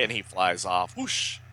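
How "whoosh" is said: high airy flying whoosh; quick comic-book swoosh; whoosh noise. Retold or imitated. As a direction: imitate a hero zooming off.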